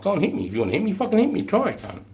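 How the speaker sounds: very high, goading challenge; taunting dare. Retold or imitated. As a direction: dare him to swing at you. Taunting a restrained recruit.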